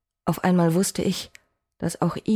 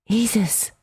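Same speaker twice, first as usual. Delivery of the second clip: urgent call; 4 semitones higher than usual. Acted. Cow calling the still goddess Isis, alarmed. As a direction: try to wake her with her name.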